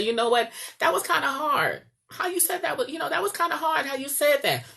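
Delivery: imitating husband. Husband telling wife she spoke too hard, mimicked high. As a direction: lift the voice way up, a hurt complaint. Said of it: "he'll come back and say".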